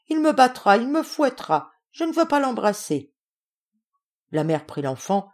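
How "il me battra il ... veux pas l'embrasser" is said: put-on high-pitched child's wail of protest, loud at first; acted small boy refusing in alarm; crying out. A narrator's reading. Youngest child afraid of being whipped, crying out. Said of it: "se mit à crier".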